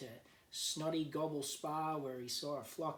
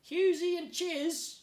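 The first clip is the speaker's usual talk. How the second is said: much higher and louder, a shocked mother emu; 11 dB louder than usual. A narrator's reading. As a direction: squawk the names back in disbelief. Cross mother emu repeats.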